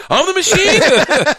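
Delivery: high, loud, drawn-out cry; exuberant boast. Retold or imitated. Comic announcing himself to roomful of gangsters, exuberant. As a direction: stretch it out high and proud.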